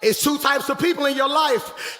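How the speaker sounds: usual speaking voice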